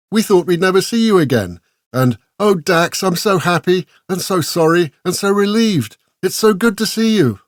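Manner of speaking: high, tearful relief in each word; crying with joy. A narrator's reading. Esmeralda hugging Dax, tearfully relieved he is alive. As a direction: pour out happy, sorry relief. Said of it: "hugging and squeezing and crying".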